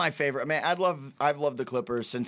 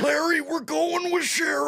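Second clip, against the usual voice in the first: high, strained shout; Super Dave voice; 14 semitones higher, 4 dB louder than usual. Retold or imitated. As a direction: belt it high and tight. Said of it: "That's a good Super Dave".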